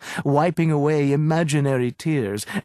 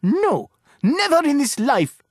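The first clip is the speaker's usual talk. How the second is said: much higher and louder, a yell; 12 semitones higher, 4 dB louder than usual. A narrator's reading. Owner refuses the agent's offer, yelling. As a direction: yell it in outrage. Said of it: "She let out a yell".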